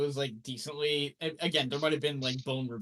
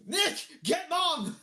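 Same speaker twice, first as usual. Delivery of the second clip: high, drawn-out holler; imitated brother hollering for help; brother yelling out; 4 dB louder, much slower than usual. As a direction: call out urgently, stretched and high. Brother calling out in story.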